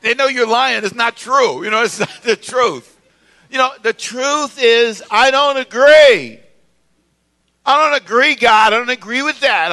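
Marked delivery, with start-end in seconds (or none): 3.5-6.4 slow, high, building to a loud finish; emphatic declaration. Shown as a demonstration. Preacher models honest admission to congregation, emphatic declaration. 7.6-9.7 raised, loud opening protest easing off; defiant protest. Acted. Believer openly objects to God, defiant protest.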